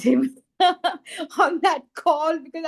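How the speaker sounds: high, laughing through the words. Real, not acted. Recalling a happy cry on a call, laughing. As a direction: let a laugh break into the phrase.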